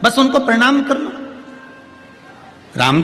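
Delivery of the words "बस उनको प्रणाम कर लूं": high, yearning devotional plea; longing plea. Acted. Widow telling Ram she only wished to bow, pleading. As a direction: lift the voice high, as if begging one small wish.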